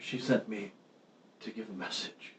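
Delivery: hushed, halting stammer of a shocked, wounded man. Acted. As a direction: falter softly through each word, long pauses between. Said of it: "he looks shocked and says".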